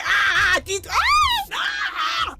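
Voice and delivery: screaming high ah ah ah; loud shrill panic cries; frantic yelling in alarm; panicked high shouts. Real, not acted. Panicked cries during gameplay.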